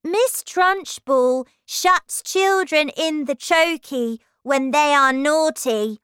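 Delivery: put-on older children scaring. A narrator's reading. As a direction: frighten the little ones with the tale. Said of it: "the older children said".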